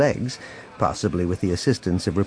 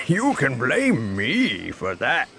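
slow, resonant crime boss; 5 semitones higher, slower than usual. A narrator's reading. Hidden boss taking the blame before guests, slow and resonant. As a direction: interrupt grandly and unhurried. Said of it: "source of the resonant voice".

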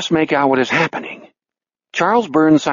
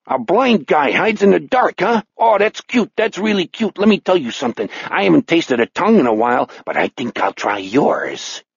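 taunting Chicago killer; mock your hidden prey in a Chicago accent; 4 semitones higher than usual. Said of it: "In a Chicago voice, he said".

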